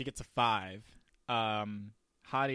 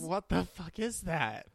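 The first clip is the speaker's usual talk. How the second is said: high shocked disbelief; baffled, horrified question; 7 semitones higher, 3 dB louder than usual. Real, not acted.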